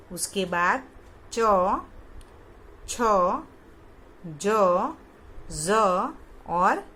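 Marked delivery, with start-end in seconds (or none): 1.3-5.7 च, छ, ज, ज़ called out loudly, each drawn out alone; letter recitation demo. Shown as a demonstration. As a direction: recite च, छ, ज, ज़ with pauses between. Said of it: "आपको उसका उच्चारण करके". (च, छ, ज, ज़ ज़ोर से, रुक-रुककर उच्चारण)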